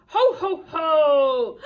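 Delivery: loud, booming belly-laugh call; big hearty Christmas call; Santa. Acted. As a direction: bellow a jolly ho-ho-ho.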